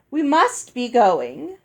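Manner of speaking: slow, loud, grand departure line; a hint of a pompous dame, slow and loud; bossy announcement. Dame Olga ushers daughters away.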